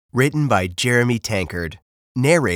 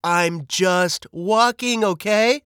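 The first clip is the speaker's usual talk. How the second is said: slow, high-pitched protest; high exasperated bird, rising on the last word; 10 semitones higher, slower than usual. Acted. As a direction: drag it out, fed up. Bird's exasperated outburst continues.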